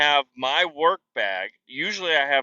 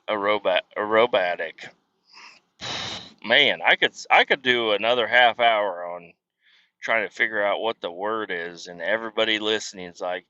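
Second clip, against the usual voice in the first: ordinary talk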